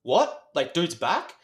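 high-pitched, incredulous "what?"; startled disbelief. Retold or imitated. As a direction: act out baffled surprise. Acted disbelief at man's return.

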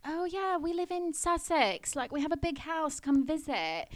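high, put-on British accent; bad British accent. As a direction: fib breezily in a badly faked British voice. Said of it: "the worst British accents you've ever heard".

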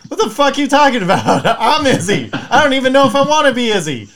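high, loud, laughing mock outrage; mock triggered outburst. A man hearing someone else order as Izzy, laughing mock outrage. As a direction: yell it half-laughing like a stranger just stole your alias. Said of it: "You're gonna be like".

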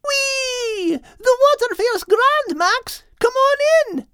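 put-on high, gleeful girl voice calling out; excited girl dog. A narrator's reading. Kate calls Max from the water, high and gleeful. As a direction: squeal it from the waves. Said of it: "shouted Kate".

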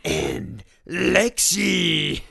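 loud, slow drawn-out shout. Pretend. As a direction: belt the name out slowly. Silly outro take.

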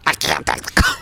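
breathy whispered panicked chicken; voicing the chicken. Storyteller voicing a panicked chicken in a whisper. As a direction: whisper it like a terrified chicken in the cone. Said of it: "you can feel that chicken being like".

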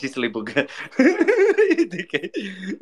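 laughing through a playful insult. Real, not acted. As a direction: crack up while teasing him, slowing down. Teasing after misheard answer.

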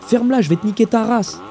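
high-pitched street yell, mildly loud; adolescent yelling. A narrator's reading. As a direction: yell an insult like a teenager. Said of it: "les hurlements de voix en pleine mue".

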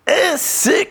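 high, drawn-out cringe; exaggerated high squeal of embarrassment; mock cringing. Reacting to the re-enacted put-down.

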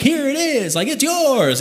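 high, lightly voiced game show host; a hint of a prize announcer. Stranger handing over a prize, mock game show host. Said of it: "Price is Right kind of stuff".

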